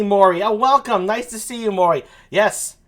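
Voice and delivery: ordinary talk, nothing marked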